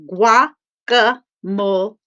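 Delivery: Guacamole said slowly, syllable by syllable; slow syllable count. Shown as a demonstration. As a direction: tap out Guacamole in even beats. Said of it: "So I'll show you how".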